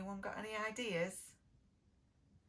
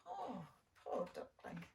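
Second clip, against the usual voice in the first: sympathetic; 3 semitones lower, 8 dB quieter than usual. A narrator's reading. Narrator pities duckling.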